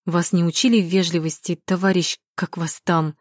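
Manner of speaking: plain reading voice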